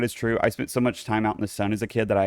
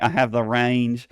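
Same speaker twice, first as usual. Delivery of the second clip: ordinary delivery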